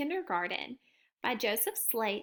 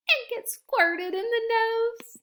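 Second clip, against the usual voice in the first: squirted surprise; 10 semitones higher, 4 dB louder than usual. A narrator's reading. Squirted in nose.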